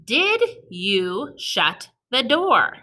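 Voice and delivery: slow, word by word dictation. Shown as a demonstration. Teacher dictating to kids word by word slowly. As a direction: say each word slowly for writing. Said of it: "you're going to write".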